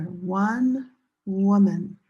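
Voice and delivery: "woman" said slowly with an "oo" vowel, WUH-mun; slow clear demo. Shown as a demonstration. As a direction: stretch out "woman" so every sound is clear. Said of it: "This is singular".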